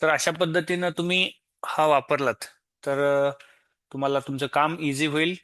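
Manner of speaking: normal talking voice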